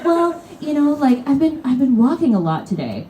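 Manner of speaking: high, whiny excuse-making. Retold or imitated. Seat hog excusing himself to the woman, whiny plea. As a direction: plead pathetically.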